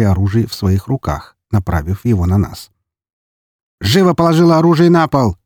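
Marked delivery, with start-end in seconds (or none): 3.8-5.4 much higher shouted command; barked order. Acted. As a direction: bark the order at her. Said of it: "рявкнул один из них".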